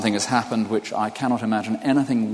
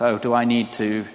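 ordinary talk, nothing marked

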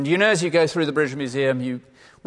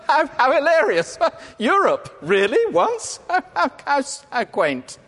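mocking laughter; 14 semitones higher, 6 dB louder than usual. Mocking future Europeans.

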